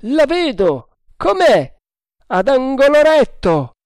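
very high, loud calls; shouted exchange. A narrator's reading. Lookout and quartermaster on the bow's shape, shouting. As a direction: trade shouts between mast and deck.

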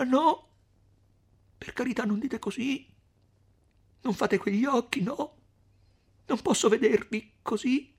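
high, begging through tears; tearful pleading. Acted. Lucia begging Renzo through tears. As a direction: plead with him, near crying. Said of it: "esclamò Lucia piangendo, supplicando".

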